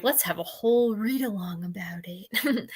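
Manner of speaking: a breathless whisper, half joking; hushed tongue-in-cheek aside; whispered mock suggestion. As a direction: whisper it like a silly idea you would never propose.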